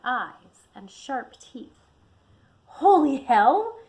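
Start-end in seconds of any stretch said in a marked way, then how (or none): 2.7-3.8 loud, slow startled yell. A narrator's reading. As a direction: yell it out in shock. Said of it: "he yelled".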